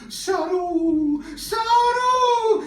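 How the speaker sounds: high, long, drawn-out calling; mother yelling. Retold or imitated. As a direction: imitate a mother calling her child from afar. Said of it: "his mother just yelling to him".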